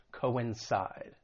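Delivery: ordinary delivery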